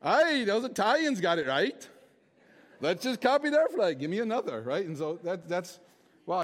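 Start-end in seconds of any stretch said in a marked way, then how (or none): 0.0-4.6 mock Irish pub accent. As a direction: play a rowdy Irishman ordering another round in an Irish accent. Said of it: "Irish guys sitting around in a pub ... they're like".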